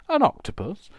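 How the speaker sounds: high, loud mimicked exclamation; friend's catchphrase. Crafter interrupts own story with friend's saying, high loud mimicry. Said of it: "a friend that used to say".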